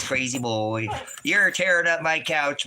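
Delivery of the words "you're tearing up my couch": mock scolding dog. Real, not acted. Dog tearing up the couch.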